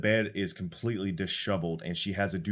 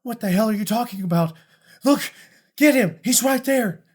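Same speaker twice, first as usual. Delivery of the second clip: shrill-high panicked shout, much higher and louder; terrified noblewoman screaming for help; panicked lady; 11 dB louder than usual. Acted. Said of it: "And she's like".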